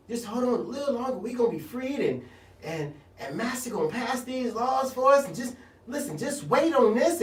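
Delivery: a soft, coaxing mock of an elder telling him to wait; patronizing elder.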